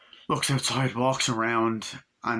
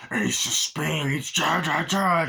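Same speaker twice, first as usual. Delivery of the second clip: slow, raised, drawn-out chant; creepy possessed voice; 4 semitones higher, much slower than usual. Retold or imitated. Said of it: "you get a creepy scene".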